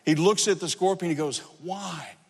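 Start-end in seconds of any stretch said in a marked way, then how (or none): 1.6-2.2 very soft, feeble question; hushed dying-frog voice. Acted. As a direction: ask it faintly, as if sinking. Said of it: "frog is sinking and about to die".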